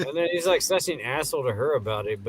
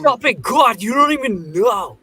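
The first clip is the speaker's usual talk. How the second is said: high, loud hammed-up whining; mock teen tantrum; 10 semitones higher, 7 dB louder than usual. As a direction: rant at dad like an exasperated teenager. Joins in as ranting son.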